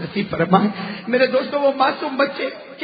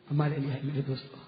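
much lower and softer, quick; hushed fast aside; 12 semitones lower, 13 dB quieter, faster than usual. Real, not acted. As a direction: murmur it to the listeners. Solemn aside to congregation. (بہت دھیمی اور تیز سرگوشی نما آواز)

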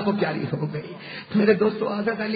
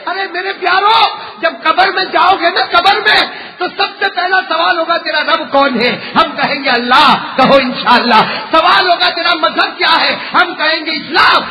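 loud, raised sermon call; preaching at full force; 3 semitones higher, 7 dB louder than usual. Real, not acted. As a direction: drive the questions home to the crowd. Preaches on the grave's questions. (پورے جوش سے وعظ)

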